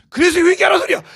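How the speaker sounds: high, loud pulpit cry; exhorting shout. Real, not acted. Preacher calls the congregation to repent, exhorting shout. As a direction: shout the call as an urgent demand.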